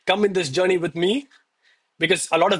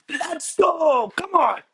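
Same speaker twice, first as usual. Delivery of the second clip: hype shout; 10 semitones higher, much slower than usual. Real, not acted. Streamer hypes his chat after a set of push-ups, pumped-up shout. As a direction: roar it out like you just won.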